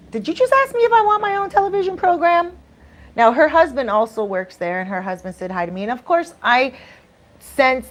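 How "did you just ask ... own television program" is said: high, loud disbelieving question; startled by an unexpected offer; stunned disbelief. Retold or imitated. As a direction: ask it as if you can't believe your ears.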